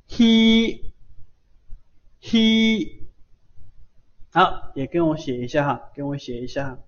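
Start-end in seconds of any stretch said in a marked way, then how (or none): 0.1-3.1 嘿 as a long, high, level "hee" on outgoing breath; teacher's sustained high model of 嘿 as "hee"; exhaled hi sound. Shown as a demonstration. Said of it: "呼气啊".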